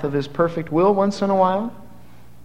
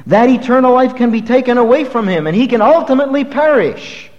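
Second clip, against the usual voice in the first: mock skeptic; 7 semitones higher, 5 dB louder than usual. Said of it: "but the skeptic says".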